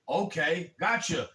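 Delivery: ordinary talk, nothing marked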